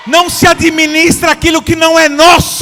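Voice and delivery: all-out pulpit shout; preaching shout. Real, not acted. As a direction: hammer the point home to the congregation. Preacher peaks over the music.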